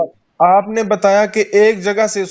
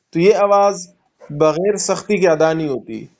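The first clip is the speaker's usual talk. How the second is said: ordinary talk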